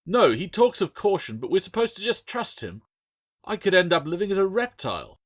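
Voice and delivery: high and loud, bitter protest; angry protest. Acted. Adrian's angry outburst at Bill.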